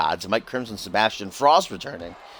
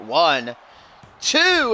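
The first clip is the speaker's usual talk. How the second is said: high loud count; shouted count; 5 semitones higher, 5 dB louder than usual. Real, not acted. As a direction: yell the count. Calling the pin count.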